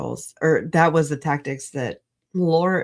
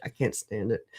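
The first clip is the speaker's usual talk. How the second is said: lower, much softer echo; quiet repeat; 8 semitones lower, 9 dB quieter than usual. Real, not acted. Streamer venting about hypocrites, muttered lower and softer. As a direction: let the repeat sink, low and soft.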